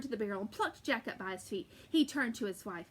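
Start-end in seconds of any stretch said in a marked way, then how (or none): none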